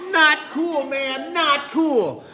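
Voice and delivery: high, loud mock-indignant fish; protesting little fish. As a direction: complain shrilly as a whacked little fish. Whacked fish complains to the shark.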